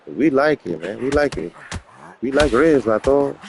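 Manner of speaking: put-on playful Chicano homeboy accent; mock Chicano street talk; Chicano vato.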